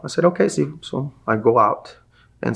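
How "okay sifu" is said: higher, breezy fake agreement; mock innocent. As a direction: agree without meaning it. Brushing off teacher's warning.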